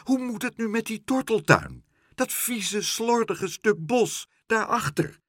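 high-pitched whining complaint; distressed, indignant whine; wailing. A narrator's reading. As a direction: lament it in a high, plaintive voice. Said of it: "jammerde mevrouw Helderder".

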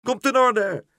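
high, loud bird squawk; screeching call. A narrator's reading. Karel accepts the errand in flight, screeching call. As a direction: squawk agreement from the air. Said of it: "riep Karel".

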